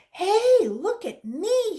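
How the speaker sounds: high, loud, thrilled shout of triumph; excited call. Acted. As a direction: call out like a kid showing off. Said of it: "he said".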